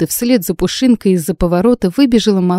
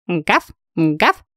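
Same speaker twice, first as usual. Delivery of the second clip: dog barks. Acted. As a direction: yap twice, gently. Dog barking.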